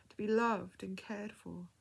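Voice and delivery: ordinary delivery, nothing marked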